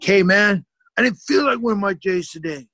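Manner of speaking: loud, high, furious outburst; exploding at friend. Retold or imitated. Teen snapping at pestering friend, loud and furious. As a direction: blow up like a volcano at him. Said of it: "I just exploded like a volcano".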